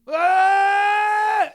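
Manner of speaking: a long, high, loud scream; imitated arm-wrestler scream. Pro arm wrestler screaming mid-match, high loud scream. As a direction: roar like a straining arm wrestler. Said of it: "some of them scream".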